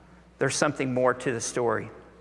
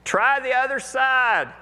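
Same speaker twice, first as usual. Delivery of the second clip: "Try" high and stretched, the whole call slow and much higher; yelled back; 9 semitones higher, 3 dB louder than usual. Retold or imitated. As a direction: shout advice across the water, unhurried. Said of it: "He yells back".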